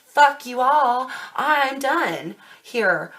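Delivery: loud, slow, defiant quote; fed-up outburst. Retold or imitated. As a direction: spit it out like someone quitting for good. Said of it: "you're ready to say".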